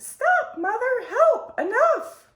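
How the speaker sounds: high panicked scream for help. A narrator's reading. Child screaming at her mother to stop, high frantic scream. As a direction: shriek it while choking on water. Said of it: "Eulalie screamed".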